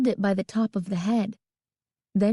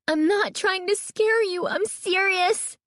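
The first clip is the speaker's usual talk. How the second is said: high, desperate protest; frantic, high-pitched insistence; distressed cry; 12 semitones higher than usual. A narrator's reading. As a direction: cry out to be believed. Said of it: "Carly Beth cried".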